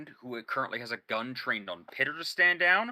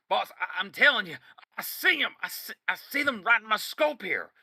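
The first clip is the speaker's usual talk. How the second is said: high, pleading and urgent; insistent; 8 semitones higher than usual. Acted. Sniper insisting to boss, high and urgent. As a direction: keep insisting he sees them. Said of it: "and he goes".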